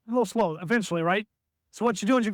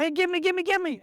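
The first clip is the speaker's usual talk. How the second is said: higher call, give me give me; eager repeated call, pitched up; calling for the ball; 10 semitones higher than usual. Shown as a demonstration. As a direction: demand the ball off the screen. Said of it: "ball screen's coming".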